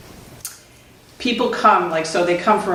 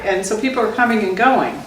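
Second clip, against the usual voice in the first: ordinary talk, nothing marked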